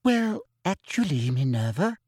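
simper it with a lilting voice. A narrator's reading. Colleague contradicting a professor, simpering. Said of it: "simpered Umbridge".

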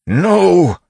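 high, full-voiced scream of denial. A narrator's reading. Miles caught by the constables, screamed. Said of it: "Miles screamed".

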